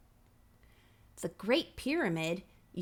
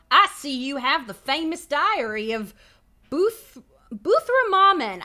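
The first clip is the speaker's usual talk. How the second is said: louder, higher, hammed-up American; a brash American stranger greeting you; American accent; 5 semitones higher, 10 dB louder than usual. Shown as a demonstration. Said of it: "it says he sounds American".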